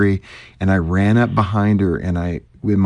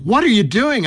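startled stranger; 12 semitones higher than usual. Retold or imitated. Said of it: "this woman said".

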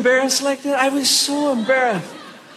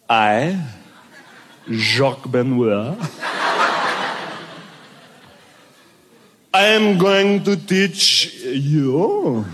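low, slow French ski instructor; hammed-up French accent with long pauses; 7 semitones lower, much slower than usual. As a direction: do a haughty, drawn-out French accent. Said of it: "he had this accent".

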